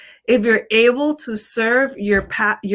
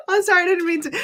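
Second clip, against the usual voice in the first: laughing apology; 10 semitones higher, 3 dB quieter, faster than usual. Real, not acted.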